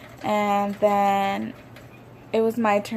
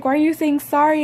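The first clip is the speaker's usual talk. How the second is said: higher, louder, fast puzzled question; lightly voiced husband, baffled; 6 semitones higher, 4 dB louder, faster than usual.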